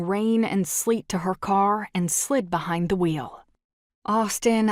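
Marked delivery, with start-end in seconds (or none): 4.0-4.7 pleading. Acted. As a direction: say "Austin," gently, hoping he'll listen. She pleads in the car.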